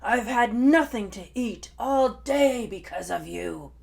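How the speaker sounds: loud exasperated roar with a growl; furious dinosaur. Acted. Hungry Tai gives up on lunch, loud furious roar. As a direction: storm off with a roar.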